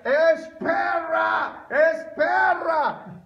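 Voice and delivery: high, drawn-out shout in broken gringo Spanish; gringo-accented cry, stretched and loud. Acted. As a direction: call after him with a mangled American accent. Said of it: "uno no habla bien el español".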